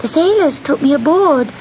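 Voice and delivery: high little cat voice, pleading; kitten's voice. A narrator's reading. Kitten excusing himself to the witch, high-pitched plea. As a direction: explain in a small high voice.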